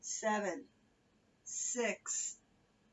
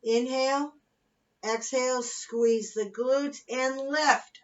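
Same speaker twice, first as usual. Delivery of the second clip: aerobics instructor; 3 semitones higher, 7 dB louder, much slower than usual. Acted. Fitness cueing and countdown.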